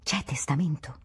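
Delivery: hushed, furtive whispered question; whisper in the ear. A narrator's reading. Relatives drawing the prince aside at the deathbed, whispering in his ear. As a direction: whisper it discreetly. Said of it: "per dirgli in un orecchio".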